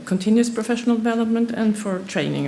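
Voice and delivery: normal talking voice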